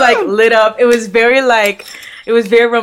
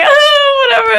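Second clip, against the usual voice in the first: laughing flustered brush-off; 6 dB louder than usual. Retold or imitated. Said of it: "And I'm like".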